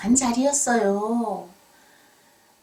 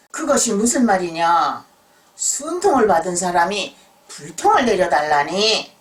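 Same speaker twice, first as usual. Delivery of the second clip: stern examiner; 9 dB louder than usual. Acted. Examiner questions the puzzling request.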